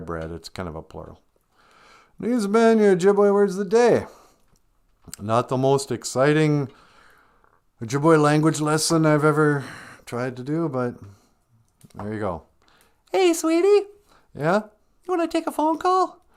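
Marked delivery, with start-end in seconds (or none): 2.2-4.1 higher, louder show-host sign-off; cheesy host outro; announcer voice. Acted. Sign-off of the lesson. 13.1-16.2 cheerful wife answering her husband; high wife voice. Acted.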